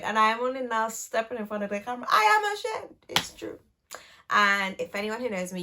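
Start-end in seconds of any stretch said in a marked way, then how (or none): 2.1-3.6 much higher, melodramatic shame; hammed-up shameful confession; mock ashamed. Mock confession after delay.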